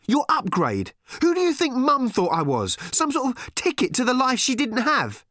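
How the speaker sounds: high, loud bitter shouting; angry teenage girl. A narrator's reading. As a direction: fling every word at him. Random shouting at her father.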